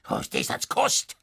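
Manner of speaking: indignant farmer's heckle in broad dialect; irate husband calling out. A narrator's reading. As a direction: berate your wife across the courtroom. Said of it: "rief der Husenbauer seiner Frau zu".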